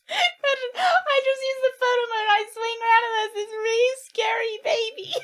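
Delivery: frightened teary telling; scared half-laughing. Real, not acted. Scary baby in photo mode.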